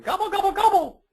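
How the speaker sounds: very high, rising-loud gobble gobble; turkey gobble. Acted. Reading the turkey page to kids, very high gobbling. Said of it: "Chatter the two plump turkeys".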